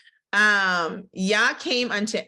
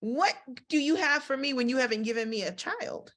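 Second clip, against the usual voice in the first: complaining Abram; 6 semitones higher than usual. Retold or imitated. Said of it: "he's like".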